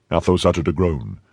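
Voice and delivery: usual speaking voice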